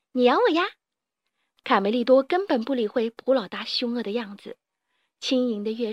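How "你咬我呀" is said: high, mocking dare; cheeky taunt. A narrator's reading. Carmelito dares the mole boss to bite, taunting. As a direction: toss it out higher and louder to goad him.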